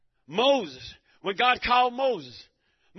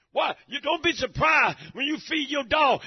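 ordinary talk

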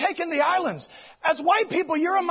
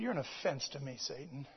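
much lower, softer, firm; quiet rebuke; 12 semitones lower, 16 dB quieter than usual. Retold or imitated. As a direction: rebuke it calmly and low.

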